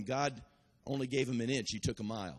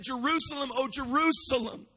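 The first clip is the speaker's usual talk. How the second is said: Jesus lamenting; 9 semitones higher, 5 dB louder than usual. Retold or imitated. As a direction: mourn over the city, half-crying. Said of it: "he looked at Jerusalem and he said".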